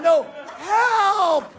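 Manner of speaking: high, loud hammed-up plea; mock cry for help. Injured rider begging the onlooker, hammed-up loud plea. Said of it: "And they're going".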